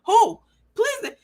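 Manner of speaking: very high, mocking disbelief; incredulous. Real, not acted. Demanding the commenter say who, scoffing.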